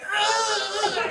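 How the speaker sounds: disgusted groan. Retold or imitated. Gecko on him.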